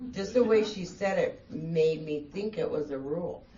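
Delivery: usual speaking voice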